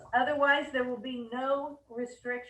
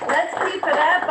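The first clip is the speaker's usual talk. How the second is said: excited cheer; 5 semitones higher, 12 dB louder than usual. Real, not acted. Cheering the team on.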